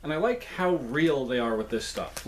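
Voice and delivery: usual speaking voice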